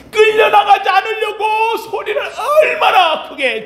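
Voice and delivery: high, strained preaching cry; straining shout. Acted. Preacher telling of the beggar resisting removal, high straining shout. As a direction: push it up high, near a scream. Acts out the screaming struggle.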